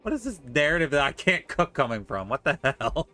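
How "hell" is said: normal talking voice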